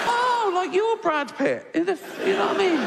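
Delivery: camp it up, squeaky and pleased; mock flattered retort. Comedian mocking the devil, squeaky sarcastic squeal.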